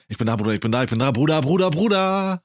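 wired, building to a high yell; too much caffeine. Retold or imitated. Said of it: "wo wir von Energy geredet haben".

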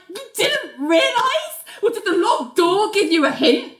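high-pitched incredulous retort; mock indignant disbelief. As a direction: act out an affronted, sarcastic comeback. Nell snaps at intruder in stall.

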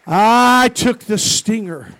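long, slow, high roar; preacher's shout. Real, not acted. Preacher driving home the bee story's point, shouting. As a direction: shout it out triumphantly, drawing out the words.

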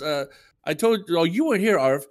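ordinary delivery

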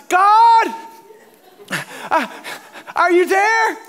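high, loud, hammed-up call to heaven; crying out. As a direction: shout up to God, mock-desperate. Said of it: "he's crying out".

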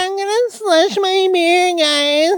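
put-on very high, squealy River Park accent. Mocking a River Park crowd's slushy beer, squealing River Park accent. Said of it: "River Park accent".

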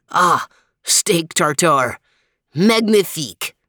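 French dog talking with mouth full. Acted. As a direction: savour it through a mouthful. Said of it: "with his mouth full".

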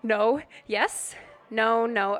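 wavering on the edge of a storybook sneeze; sneeze coming on. A narrator's reading. Reader acts the king fighting a sneeze, sneeze building. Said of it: "he felt a sneeze coming on".

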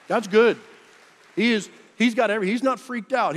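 loud, high, fast proclamation; preaching shout. Real, not acted. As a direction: belt it out to the whole church. Preacher proclaiming at full force.

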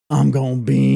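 hammed-up battle cry; worked-up bean eater. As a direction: play at getting all worked up, loudly. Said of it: "So he gets all worked up".

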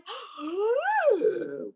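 a sudden gasp of shock; startled intake of breath; sharp shocked gasp. Acted. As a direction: gasp as if struck by the news.

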